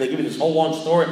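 ordinary delivery

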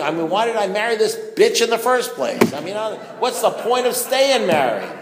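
loud, high-pitched retold outburst; exasperated rant. Acts out his angry rant.

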